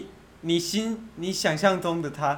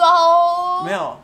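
high, slow and loud; drawn-out high; 11 semitones higher, 7 dB louder, much slower than usual. Acted.

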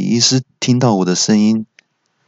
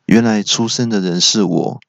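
plain reading voice

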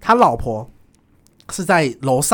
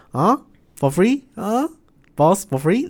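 mock Malaysian accent; 6 semitones higher, slower than usual. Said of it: "然后要学马来西亚腔".

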